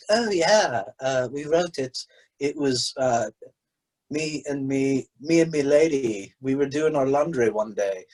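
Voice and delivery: lightly put-on British accent for a rock songwriter; mock British accent. Mocking a songwriter's interview in a put-on British accent. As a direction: imitate an English rock musician, casually. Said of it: "I keep doing that accent".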